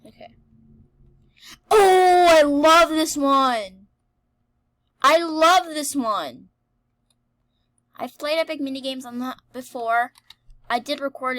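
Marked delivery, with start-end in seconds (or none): 1.7-3.9 high, loud, slow gushing squeal; excited squeal. Real, not acted. Favourite game appears. 5.0-6.5 a bit calmer, slow and loud; excited. Real, not acted. Repeats with less force.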